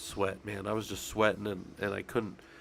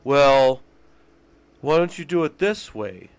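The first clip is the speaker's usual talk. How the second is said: loud, higher, mocking a backseat critic; 4 semitones higher, 10 dB louder, slower than usual. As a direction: blast it out like a pushy viewer. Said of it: "somebody being like".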